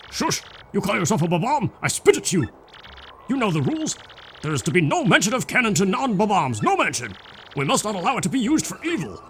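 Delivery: put-on a huffy elder scolding the young one; lightly voiced elder defending the rules; indignant elder. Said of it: "Saying what I think he should say".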